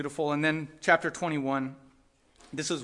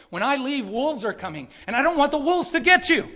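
rising to a loud, higher cry on "and I don't want the wolves to get you"; pleading Paul warning; 8 semitones higher, 7 dB louder than usual. Retold or imitated. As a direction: build Paul's warning into an anguished plea. Voicing Paul's tearful farewell warning.

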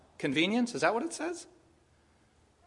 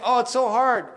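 higher and louder mock complaint; mock whining; 5 semitones higher, 6 dB louder than usual. Preacher mocking Israel's priests, louder mock whine. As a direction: sneer out the lazy priest's whine. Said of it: "You're saying".

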